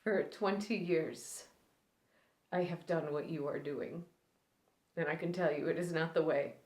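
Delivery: quiet, grieving recluse with long, halting pauses; Allie Mayo. Acted. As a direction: start hushed, let old grief push the pitch up, then sink back. Performs Allie Mayo's grieving monologue.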